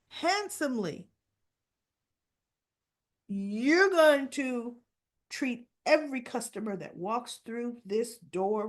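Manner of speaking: slow, emphatic scolding; measured outrage with heavy pauses; indignant dressing-down. Retold or imitated. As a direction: put the employee in their place, phrase by phrase. Acts out husband scolding waiter.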